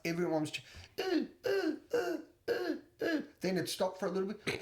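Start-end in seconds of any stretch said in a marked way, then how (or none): 1.0-3.2 short, very high whimpers; imitated dog whimper. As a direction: whimper like a dog left alone, in repeated little cries. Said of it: "This poor dog is whimpering".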